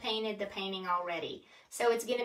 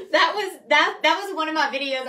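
laughing; 6 semitones higher, 11 dB louder, faster than usual. Real, not acted. Embarrassed about messed-up video.

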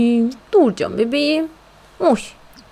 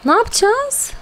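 panicked; 12 semitones higher than usual. Real, not acted. Panicked repeat of question.

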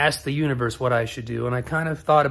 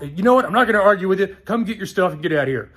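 higher, louder fed-up outburst; imitating angry outburst; 5 semitones higher, 5 dB louder, faster than usual. Owner ending a phone argument, angry. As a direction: throw it at him, fed up.

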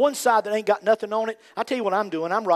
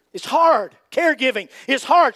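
emphatic cry; 7 semitones higher, 8 dB louder than usual. Real, not acted. Loud emphatic.

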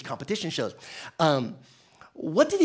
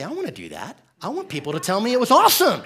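eager wish; 6 semitones higher, 7 dB louder, faster than usual. Retold or imitated. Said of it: "And so I told Kelly".

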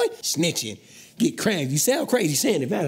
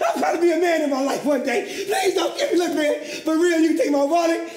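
high, panicked begging, pitched way up; pleading for his life; 7 semitones higher than usual. Acted. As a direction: beg them desperately not to shoot. Begging robbers not to kill him.

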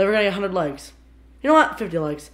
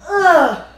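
disgusted groan; 8 semitones higher, 10 dB louder than usual. Real, not acted. Reacting to drinking soy sauce.

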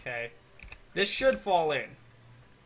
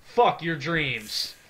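loud snap, then slow taunt; imitated breakage; 2 semitones higher, 9 dB louder, much slower than usual. Gamer voicing wood pieces about to break, mock taunt. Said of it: "it's just gonna be like".

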